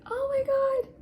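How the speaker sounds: very high thrilled gasp of joy; delighted squeal. Retold or imitated. Her reaction to being recognized.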